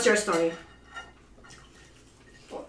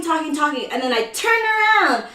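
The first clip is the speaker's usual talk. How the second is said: ordinary delivery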